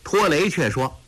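ordinary delivery

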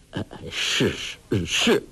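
put-on a touch of a cowed old man's fear; trembling stammer. A narrator's reading. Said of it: "全身颤抖".

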